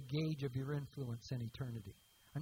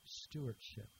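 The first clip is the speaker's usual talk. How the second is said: soft echo; 4 semitones lower, 8 dB quieter, slower than usual. Shown as a demonstration. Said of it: "the emphasis is on stewardship".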